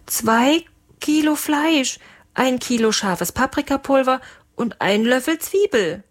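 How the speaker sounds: high, hesitant recital; timid cook. Acted. Intimidated cook recites the recipe to his captain, high and timid. Eingeschüchterter Koch zählt Rezept auf.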